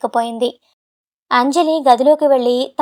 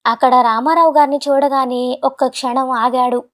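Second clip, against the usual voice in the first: ordinary delivery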